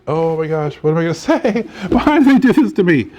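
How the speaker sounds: rising into a high, loud laughing wail; mock horror breaking into laughter; hammed-up shock at the paint color; laughing dismay. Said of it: "And I was just like".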